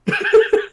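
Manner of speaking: squealing laugh burst; very high giggle. Real, not acted. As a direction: shriek of laughter. Narrator cracks up.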